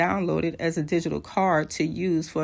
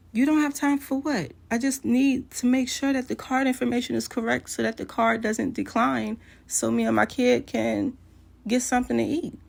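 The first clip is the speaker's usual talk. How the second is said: high-pitched protest; frustrated, insistent explaining, pitched up; retold exasperated plea; 7 semitones higher than usual. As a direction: act out arguing her case over the phone. Her pleading side of acted quarrel.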